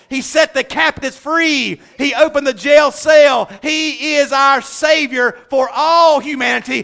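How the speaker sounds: high, driving sermon crescendo; impassioned pulpit delivery; full-force preaching. Real, not acted. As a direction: build each phrase into a rousing proclamation.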